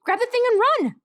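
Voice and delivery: high, loud urgent yell; high shout. A narrator's reading. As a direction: yell it high to make him run. Colt shouts to flee.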